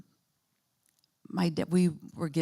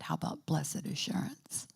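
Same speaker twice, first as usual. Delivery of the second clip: hushed whisper of a question; 10 dB quieter than usual. Retold or imitated. Daughter whispering a hymn to her dying father. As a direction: ask it barely above breath.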